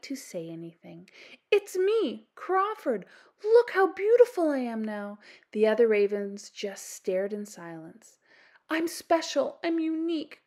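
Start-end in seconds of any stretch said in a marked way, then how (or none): 1.5-5.2 high, bragging raven voice; proud show-off. Acted. Vain raven boasting to other ravens, high proud voice. 8.7-10.4 high, smug boast. Acted. Vain raven boasting about himself, high smug brag. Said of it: "Crawford boasted".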